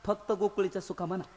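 put-on softly into the old man's ear; quiet correction in an ear; said into his ear. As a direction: murmur the correction close. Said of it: "बाबाच्या कानात म्हणलं".

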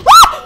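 sudden shrill shriek of fright; scream, caught off guard; startled scream. Real, not acted. Jump scare.

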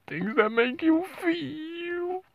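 very high, drawn-out crybaby wail; mock whining. Host mocking people who bitch and moan online, mock whining. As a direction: stretch it into a slow, high mock sob.

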